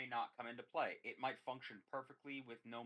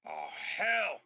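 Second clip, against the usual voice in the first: high, loud distant holler; yelled exclamation; 9 semitones higher, 12 dB louder than usual. Acted. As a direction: yell it out from down the street. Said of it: "yells back out at you".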